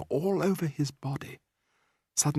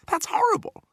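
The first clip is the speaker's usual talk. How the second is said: put-on much higher, a boy's cry of protest; cried out. A narrator's reading. Boy protesting at the ant-roasting bully, cried out. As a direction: blurt it out in horror. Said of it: "I cried".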